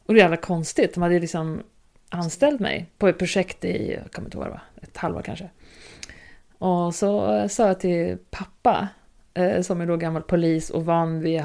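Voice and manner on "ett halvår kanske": normal talking voice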